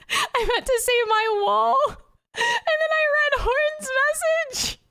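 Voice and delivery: very high, squeaky words through laughter; helpless laughter. Real, not acted. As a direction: barely get it out while cracking up. Cracking up over own slip.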